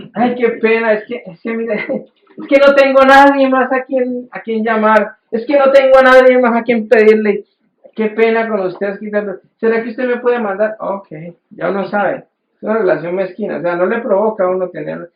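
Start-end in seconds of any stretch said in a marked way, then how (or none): 0.1-10.8 quejido agudo y suplicante; pariente pedigüeño. Retold or imitated. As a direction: imita al pariente que llama solo para pedir. Pariente interesado pide plata. (la voz aguda y lastimera del familiar interesado)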